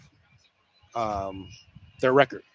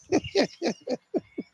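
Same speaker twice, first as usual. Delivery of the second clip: laugh; 12 semitones higher, 3 dB quieter than usual. Real, not acted. Laughing at own joke.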